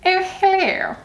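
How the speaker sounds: high-pitched Queen's English greeting; Queen's English accent. Acted. Vlogger showing her habit from watching The Crown, high Queen's English accent. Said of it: "the Queen's English accent every once in a while".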